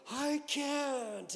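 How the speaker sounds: loud, high, drawn-out mock panic; retold shriek of refusal; imitated scream. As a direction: wail it as a terrified jumper, acted. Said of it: "you hear her scream".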